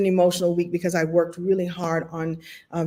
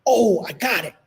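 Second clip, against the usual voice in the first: mock sudden realization; acted aha moment; 2 semitones higher than usual. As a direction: play the penny dropping. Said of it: "I call those ahas or oh snaps".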